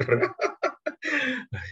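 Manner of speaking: high burst of laughter. Real, not acted. Preacher laughing at being told to leave, high laugh.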